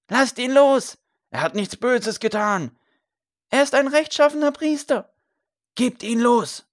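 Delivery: high, loud cries hurled from all sides; crowd shouts. A narrator's reading. Farmers demand the priest be freed, loud high shouts. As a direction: shout out in protest like an angry crowd. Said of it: "ihre Meinung herauszurufen".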